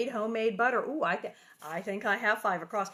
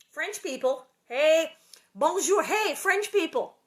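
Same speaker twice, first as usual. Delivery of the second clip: high, loud hail to French viewers; calling out; 7 semitones higher, 9 dB louder, slower than usual. Real, not acted. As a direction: shout hello across to them.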